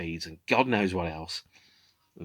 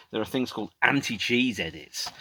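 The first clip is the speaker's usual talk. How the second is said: ordinary talk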